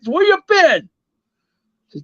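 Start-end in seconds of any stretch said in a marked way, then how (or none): 0.1-0.9 a much higher, pushy "Where you been?"; impatient Don. Retold or imitated. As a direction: fire the question up high at your friend. Don asks teller where he was.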